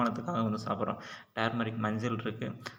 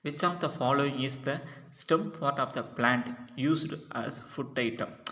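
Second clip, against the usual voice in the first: each English word read out slowly and clearly, a little higher; slow question reading; 4 semitones higher, much slower than usual. Shown as a demonstration. Teacher reading the quiz question to students, slow and deliberate.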